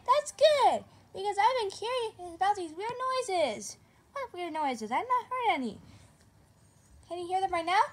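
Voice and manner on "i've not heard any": ordinary talk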